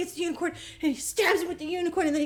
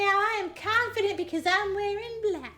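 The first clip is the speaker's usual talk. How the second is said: high, put-on girly voice; mock prissy heroine; 8 semitones higher, slower than usual. A friend mocks Lily bragging about her powers in a high put-on voice. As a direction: sneer at her smug new confidence. Mocking Lily's confident speech.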